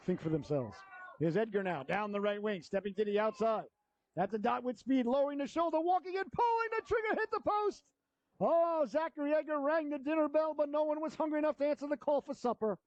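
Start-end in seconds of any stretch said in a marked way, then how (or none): 1.2-3.6 hockey announcer. Real, not acted. Live hockey play-by-play. 4.2-12.8 much higher, building shot call; excited call. Real, not acted. Shot hits the post.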